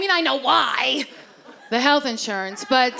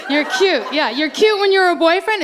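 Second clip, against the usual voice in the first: ordinary talk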